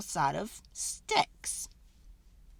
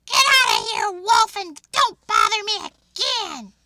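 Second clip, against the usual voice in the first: high, loud rude shout; rude pig; 11 semitones higher, 10 dB louder than usual. Acted. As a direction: bark the wolf off rudely. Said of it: "that rude little porker answered".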